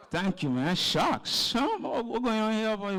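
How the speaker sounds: usual speaking voice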